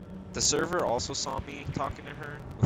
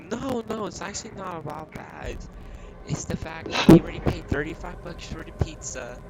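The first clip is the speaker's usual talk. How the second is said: much higher, imitating a complaining lady; mock whiny customer; 7 semitones higher, 5 dB louder than usual. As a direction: parody her indignant protest in a high voice. Mocking the angry customer's complaint.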